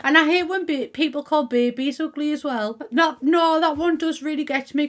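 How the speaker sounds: ordinary talk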